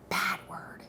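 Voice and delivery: breathy stage whisper, no voice; whispered aside. Real, not acted. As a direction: drop to a secret whisper to the audience. Aside scolding the word competitor.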